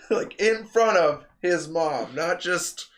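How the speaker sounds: ordinary delivery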